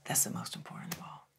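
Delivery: very soft, weighty answer; hushed reveal. Retold or imitated. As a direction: imitate a mentor confiding the key lesson. Mentor reveals the final answer.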